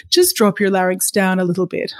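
ordinary talk

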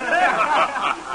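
a big laugh at the gentleman trap joke; hearty laugh. Acted. Trapper laughing at the store owner's pun, hearty laugh.

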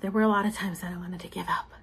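hushed, fast whispered confession. Real, not acted. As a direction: whisper it as a private admission. Repeats line as a whisper.

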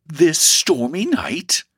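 slow, high-pitched disbelief; shocked exclamation. A narrator's reading. Mother hears guest will go out, shocked. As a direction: exclaim in kindly dismay. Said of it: "ejaculated kind Missus Alford".

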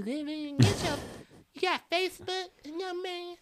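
high, soft sweet voice; mock older lady. Older woman asking for his Facebook, high soft sweet voice. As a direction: play a sweet old lady. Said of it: "She's like".

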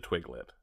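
"Twiglet" in place of Twilight, said "TWIG-lit"; deadpan "Twiglet" as if the normal pronunciation; joke mispronunciation. Shown as a demonstration. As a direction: say "Twiglet" with a hard g and short i. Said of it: "I've always pronounced it".

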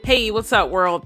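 ordinary talk

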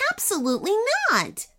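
high, quick indignant denial; affronted girl, pitched right up; insulted sniff. A narrator's reading. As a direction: sniff it, a little offended. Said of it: "sniffed Anania, feeling a little insulted".

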